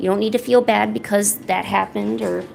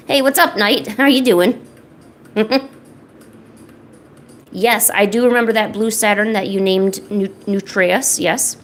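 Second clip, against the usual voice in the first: ordinary talk, nothing marked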